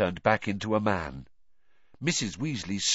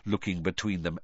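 ordinary delivery, nothing marked

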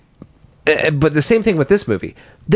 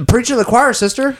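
sassy retort; 7 semitones higher than usual. Retold or imitated. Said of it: "I was like".

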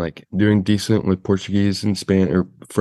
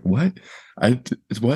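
higher-pitched, taken-aback questioning; retold bafflement; 5 semitones higher than usual. Traveler retelling his reaction to being cursed at, baffled. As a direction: act out a stunned, stammering "what?".

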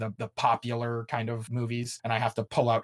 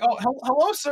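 high, sugary mock greeting; fake politeness; 11 semitones higher, 5 dB louder than usual. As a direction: put on an innocent host voice. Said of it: "they're like".

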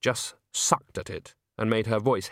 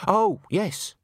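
high condescending voice; 9 semitones higher, 3 dB louder than usual. Acted. Patronising voice from the darkness.

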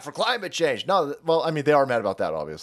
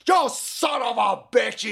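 hammed-up angry shout, higher; mock angry yell; 7 semitones higher than usual. Left-hand side cursing at Elon Musk, mock yelling. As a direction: bellow it like an angry mob. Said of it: "And the left-hand side is like".

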